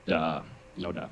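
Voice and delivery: low, slow, quiet deadpan; dead serious. Retold or imitated. Niece to her uncle, imitated low dead-serious duh. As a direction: drop it low and slow, stating the obvious. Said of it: "just dead serious, like".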